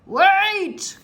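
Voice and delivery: high, loud yell; captain calls after his men; desperate shout. A narrator's reading. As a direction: call after them. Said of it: "cried Captain Bones".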